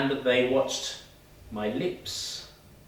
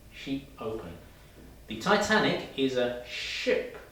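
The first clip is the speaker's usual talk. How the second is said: ordinary talk, nothing marked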